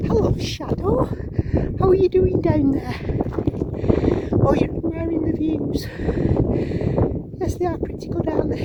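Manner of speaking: high, loud pet-talk voice; cheerful greeting to Shadow down below, high pet talk; talking to the dog. Real, not acted.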